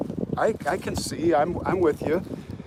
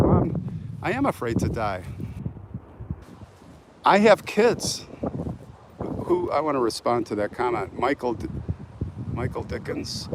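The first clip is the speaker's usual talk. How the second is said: ordinary delivery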